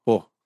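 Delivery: "po" sin la R final; R final omitida. Retold or imitated. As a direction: imita el habla sin R, diciendo "po". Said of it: "sino dice po". ("po", comiéndose la erre del final)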